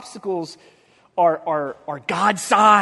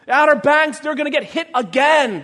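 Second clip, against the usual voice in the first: usual speaking voice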